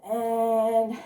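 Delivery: usual speaking voice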